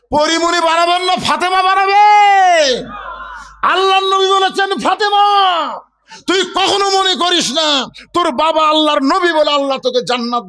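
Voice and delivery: high, ringing shouted sermon; full-force preaching. Real, not acted. Preacher quoting the Prophet warning his daughter Fatema, full force. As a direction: cry it out to the whole crowd.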